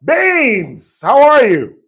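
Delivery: high, loud, drawn-out hail; hearty on-air greeting. Real, not acted. Radio host greeting caller Baines, hearty high shout. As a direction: boom out a welcome to the caller.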